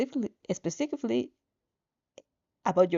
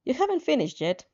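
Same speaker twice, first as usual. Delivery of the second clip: ordinary delivery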